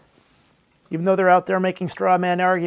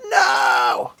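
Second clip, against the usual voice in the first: mock anguished no; 4 semitones lower than usual. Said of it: "the trolls were like".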